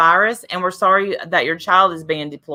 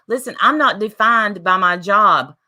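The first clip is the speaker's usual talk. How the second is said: usual speaking voice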